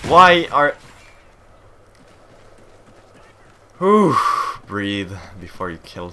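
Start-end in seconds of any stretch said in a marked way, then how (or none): 0.1-4.2 sharp loud burst then a big blown-out whew; frustrated exhale. Real, not acted. Gamer blowing off steam after a death, loud exhale. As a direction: let the frustration out in one loud breath. Rage moment in online match.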